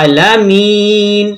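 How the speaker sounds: "আলামিন" chanted slowly with long "আ" and "মি"; drawn-out syllables. Shown as a demonstration. Teacher models the verse ending, "আলামিন" drawn out. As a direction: stretch "আ" and "মি" in "আলামিন". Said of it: "একটু টেনে পড়বো".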